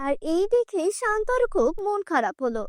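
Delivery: ordinary delivery, nothing marked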